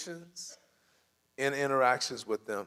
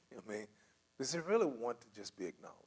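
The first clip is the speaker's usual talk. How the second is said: ordinary talk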